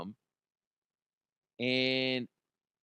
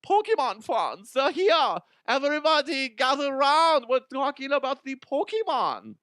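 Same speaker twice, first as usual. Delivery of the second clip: put-on announcer accent; 14 semitones higher, 6 dB louder than usual. Summoning Pokemon fans to gather round, a hyped high announcer voice. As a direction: call the fans in like a carnival barker. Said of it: "Don't know why I went into that accent".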